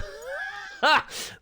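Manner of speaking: high burst of helpless laughter; exasperated laugh. Real, not acted. Laughing at the technical chaos.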